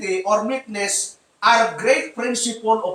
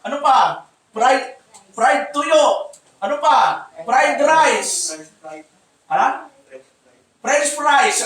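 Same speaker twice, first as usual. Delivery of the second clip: ordinary talk, nothing marked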